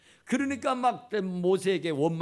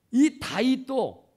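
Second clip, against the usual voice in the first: loud, very slow, higher 이 다윗도; preacher pounding home the name of David; emphatic; 6 semitones higher, 8 dB louder, much slower than usual. Acted. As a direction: hammer each syllable of 이 다윗도.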